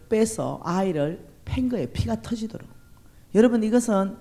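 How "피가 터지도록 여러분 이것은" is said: ordinary talk, nothing marked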